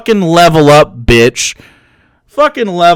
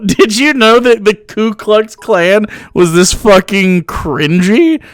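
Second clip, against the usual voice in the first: ordinary talk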